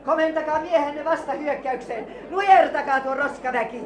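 high, loud outraged queen; furious demands. Acted. Queen demanding her guard fire back at the attackers, furious shouting. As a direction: shout angry questions at a failing commander.

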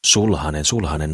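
ordinary delivery

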